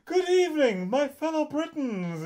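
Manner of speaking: high, theatrical cartoon lion voice; Snagglepuss voice. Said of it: "fucking Snagglepuss to do a presentation".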